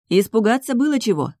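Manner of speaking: ordinary delivery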